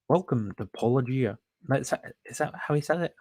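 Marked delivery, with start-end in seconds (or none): 0.1-1.4 imitating Canadian accent. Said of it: "I can't quite do the Canadian accent".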